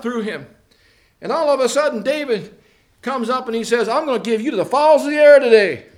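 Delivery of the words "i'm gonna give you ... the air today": loud, high-pitched challenge; defiant declaration. Acted. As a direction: call it out boldly at the giant. David defies the giant.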